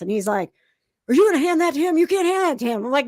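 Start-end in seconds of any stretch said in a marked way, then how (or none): 1.1-2.8 higher, louder imitated guard; alarmed challenge. Guard retold challenging her over the socks, alarmed. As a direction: voice an official stopping a handover.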